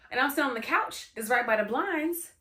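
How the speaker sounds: normal talking voice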